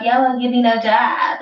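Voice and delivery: lightly voiced whiny complainer; a hint of a sulky job-seeker; mock whiny plea. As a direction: whine it like a beggar, half-mocking. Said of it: "You was begging for a job".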